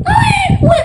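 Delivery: very high mock baby crying owe; baby wail. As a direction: wail like a newborn, high and thin.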